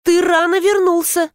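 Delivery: high woman's voice, mildly called; calling out. A narrator's reading. Wife greeting her early husband in the corridor, calling high. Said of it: "закричал из коридора женский голос".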